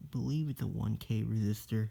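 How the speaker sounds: ordinary talk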